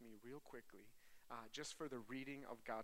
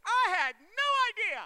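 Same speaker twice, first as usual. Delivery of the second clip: high, very loud comic rant; shouted protest of a baffled dad; exasperated shouting; 20 dB louder than usual. Acted. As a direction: yell it at full volume, indignant and high-pitched. Comic rant about dressing babies.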